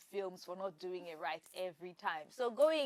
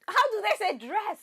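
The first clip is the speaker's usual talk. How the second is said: high, loud exasperated question; frustrated outburst; 9 semitones higher, 7 dB louder than usual. Real, not acted. Host stuck on the accent, loud frustrated outburst. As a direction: cry out, stuck on the word.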